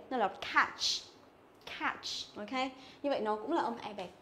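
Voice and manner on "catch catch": "catch" with a wide, open æ; E bẹt catch. Shown as a demonstration. Teacher shows the correct sound, open "catch". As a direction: flatten the mouth wide for the vowel in "catch". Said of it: "phải đọc nó là e bẹt".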